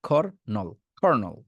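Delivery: খর, নল apart, then কর্নল joined; khor... nol... khornol; syllable-by-syllable colonel. Shown as a demonstration. Teacher breaks it in two for students, then joins khor-nol. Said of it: "নলকূপের নল বলবেন, আর খর বলবেন".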